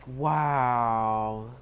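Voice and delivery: drawn-out, unimpressed wow; sarcastic wow. Retold or imitated. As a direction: stretch it out in mock awe. Sarcastic reaction to crowded bathroom.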